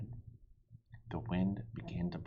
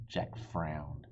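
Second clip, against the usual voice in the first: usual speaking voice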